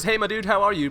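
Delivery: usual speaking voice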